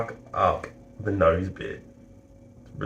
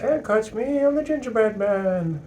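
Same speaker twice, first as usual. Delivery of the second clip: high, sing-song taunt; gingerbread man voice; 13 semitones higher than usual. Pretend. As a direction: tease like a runaway cookie.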